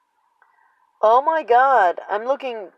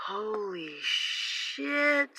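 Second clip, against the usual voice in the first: awed gasp; 9 dB quieter, much slower than usual. Real, not acted. Stunned by the birds.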